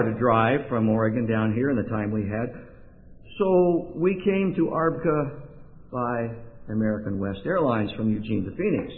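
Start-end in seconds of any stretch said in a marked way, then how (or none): none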